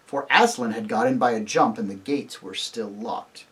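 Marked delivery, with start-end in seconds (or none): none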